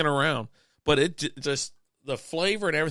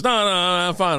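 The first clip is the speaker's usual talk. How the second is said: higher, louder mock protest; insistent denial; 5 semitones higher, 5 dB louder than usual. Sick boss brushing off the worried team, mock insistent. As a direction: wave off concern, higher and louder.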